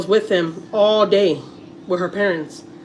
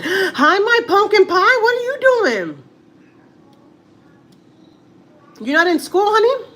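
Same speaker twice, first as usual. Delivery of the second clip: delighted high coo, stretching out what are you doing; 13 semitones higher, 4 dB louder, slower than usual. Real, not acted. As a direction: coo over the little nephew like he's the sweetest thing. Greeting nephew on call.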